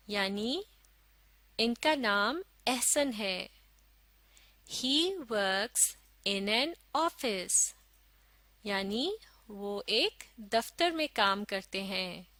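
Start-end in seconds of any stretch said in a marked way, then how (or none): 4.7-7.6 very slow, word by word; slow model reading. Shown as a demonstration. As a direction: read it out slowly for learners to follow. Second model reading for learners. (धीरे-धीरे, एक-एक शब्द पढ़कर)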